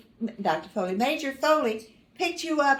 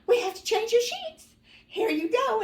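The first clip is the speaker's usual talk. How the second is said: higher, mock-bossy nurse voice; 11 semitones higher than usual. Mother imitating the nurse handing over sheets, higher mock voice. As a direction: pitch it up to imitate her.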